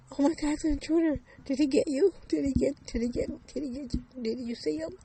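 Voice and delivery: high, loud, fast and frantic, stumbling over words; frantic panic. Acted. Mother just woken from a nightmare asks daughter if she's hurt, frantic and high. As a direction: blurt it out in breathless panic.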